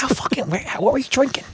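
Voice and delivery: high, quiet disbelief; incredulous squeak. Acted. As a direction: squeak out how could you.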